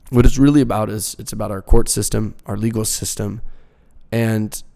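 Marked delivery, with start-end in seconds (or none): none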